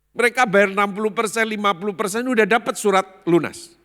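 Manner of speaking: high, loud emphatic report; indignant exclaiming. Retold or imitated. Friend telling the pastor others paid only half, emphatic. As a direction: pile it up loudly in a high voice.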